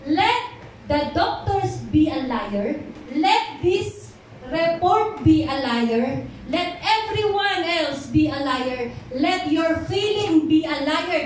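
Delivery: loud, slow preaching declaration; fervent sermon call, loud and slow. Acted. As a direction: proclaim it to the congregation. Preaching at full force.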